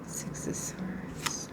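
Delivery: slow breathy whisper of the card name. Real, not acted. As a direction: whisper it slowly to yourself. Card drawn, whispered aside.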